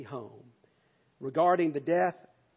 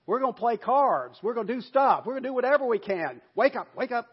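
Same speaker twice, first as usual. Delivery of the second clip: calling out to stay awake; 7 semitones higher, 8 dB louder than usual. Acted. Servants keeping each other awake.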